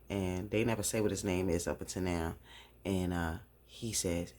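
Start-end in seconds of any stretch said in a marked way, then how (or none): none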